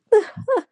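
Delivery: short burst of laughter after a rant; self-conscious laugh. Real, not acted. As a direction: laugh off your own rant. Laughs off own long rant.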